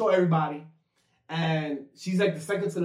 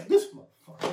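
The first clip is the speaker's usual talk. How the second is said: mock exasperation; 11 semitones higher than usual. Retold reaction to the fall.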